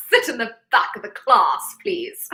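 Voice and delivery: hammed-up, badly done British accent; a mock-posh British teacher ordering him to the back. Repeating a viewer's late message in a jokey bad British accent. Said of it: "that was a horrible British accent".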